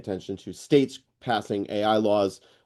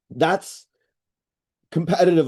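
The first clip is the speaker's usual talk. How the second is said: talking through a cough; throat still catching from water; 5 semitones higher, 3 dB louder than usual. Real, not acted. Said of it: "Excuse me, I'm coughing. water went down the wrong pipe".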